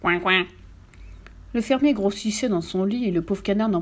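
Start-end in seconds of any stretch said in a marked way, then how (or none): 0.0-0.5 short quacked answer; duck quack. A narrator's reading. Duck answering the farmer, resigned little quack. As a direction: quack twice in reply, at an even pitch. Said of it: "le canard répondait".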